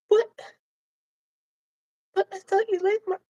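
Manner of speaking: high, quick and hurt; high vagina voice. Acted. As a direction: protest in a tiny voice. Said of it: "But you gotta talk like that".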